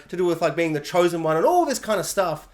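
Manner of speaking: ordinary talk, nothing marked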